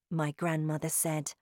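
ordinary delivery, nothing marked

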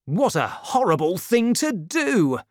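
a touch of an outraged cry. A narrator's reading. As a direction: Porthos protests the arrest, mildly indignant. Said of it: "cried Porthos".

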